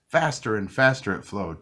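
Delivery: usual speaking voice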